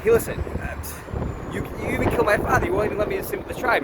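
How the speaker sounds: high-pitched, hurt protest, slowed at first; indignant plea. Retold or imitated. Outcast confronting Moses, high and indignant. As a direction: complain to the man who killed your father.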